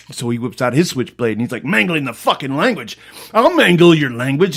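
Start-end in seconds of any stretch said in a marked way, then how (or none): 1.7-4.6 louder tough-guy snarl; mock threat. Pupil with switchblade threatening teacher, loud mock snarl. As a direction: throw the threat back at her.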